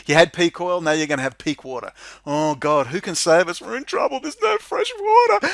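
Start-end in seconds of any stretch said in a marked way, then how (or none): 2.3-5.5 mock panic. Mocking public panic over water.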